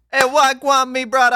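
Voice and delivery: loud, high Caribbean-style troll; troll accent. Pretend. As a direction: boom it out as a game troll. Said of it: "Redeemed accent troll?".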